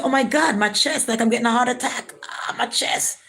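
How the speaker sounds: high, breathless panic about her chest; panicked gasping. Retold or imitated. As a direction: gasp out the pain in fear. Retelling heart attack scare.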